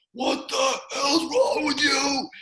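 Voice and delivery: high, drawn-out mock shout of a coach; imitated screaming. Coach screaming at his player in a timeout, imitated yell. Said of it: "he's screaming at him".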